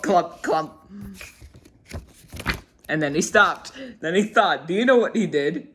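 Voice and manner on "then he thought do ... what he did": ordinary delivery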